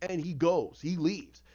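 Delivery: ordinary delivery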